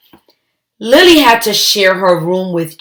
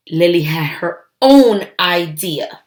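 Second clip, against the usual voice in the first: usual speaking voice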